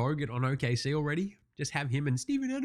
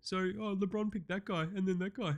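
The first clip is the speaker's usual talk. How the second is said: soft, higher-pitched feigned interest; mock underwhelmed; 6 semitones higher, 6 dB quieter than usual. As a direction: react politely with half-hearted, sarcastic approval. Mimics underwhelmed viewer of announcement.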